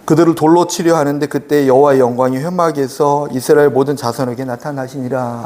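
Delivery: low, even Bible-reading voice; formal pulpit reading of scripture. Real, not acted. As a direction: read the verses solemnly, in a measured low tone. Reads the Bible passage aloud.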